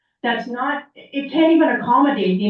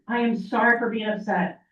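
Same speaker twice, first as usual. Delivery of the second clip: ordinary talk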